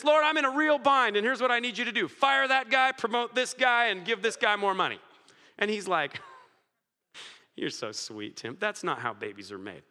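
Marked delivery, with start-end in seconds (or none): 0.0-5.0 demanding prayer. Retold or imitated. Bossy prayer ordering God around. 7.5-9.8 gentle God. Retold or imitated. God answering Tim gently.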